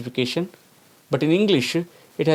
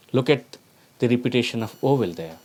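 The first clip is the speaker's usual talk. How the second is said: usual speaking voice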